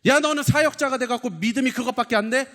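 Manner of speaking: high, loud mock scolding; acted rebuke. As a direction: scold like an exasperated superior. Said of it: "책망하지 않으셨습니다".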